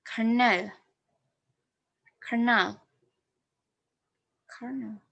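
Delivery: "kernel" with a syllabic n at the end; syllabic n demo. Shown as a demonstration. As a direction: say "kernel" slowly, ending on a held n. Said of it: "pronunciation demo of kernel".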